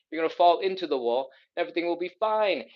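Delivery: ordinary delivery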